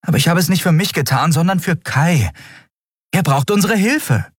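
a hint of a pleading young woman; lightly voiced Endriel. A narrator's reading. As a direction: press the point, hurried and earnest.

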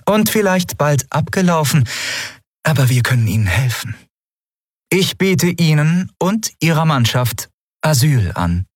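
calm, polite ruler holding back a secret; lightly voiced empress. A narrator's reading.

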